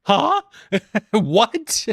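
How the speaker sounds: high surprised what with a laugh; stunned huh. Retold or imitated. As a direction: gasp it out in shock. Sees fourteen thousand likes.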